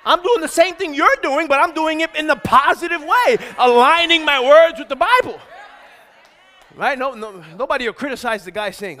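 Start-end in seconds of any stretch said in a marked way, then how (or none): 0.1-5.4 high, loud pulpit shout; impassioned, raised-voice rebuttal; forceful preaching. Real, not acted. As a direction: drive the point home, building in volume. Preacher defends himself to congregation.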